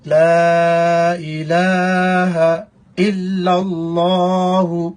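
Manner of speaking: slow, measured Arabic recitation. Shown as a demonstration. Teacher reciting the kalima for students, slow measured recitation. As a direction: recite each phrase slowly and deliberately.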